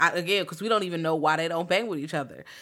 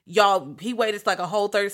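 ordinary delivery

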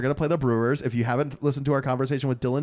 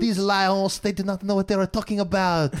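a hammed-up French accent, pitched higher; mock Frenchman trashing the Lions, in a French accent; 5 semitones higher than usual. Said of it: "I can't do French".

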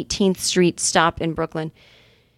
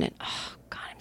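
unvoiced, grumbling whisper; 18 dB quieter than usual. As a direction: whisper a sour grumble to yourself. Grumbling to herself, hungover.

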